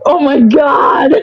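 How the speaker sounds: real shocked exclamation; shocked outcry. Real, not acted. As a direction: cry out in scandalized amusement, stretching it slowly. Stunned reaction to a dark joke.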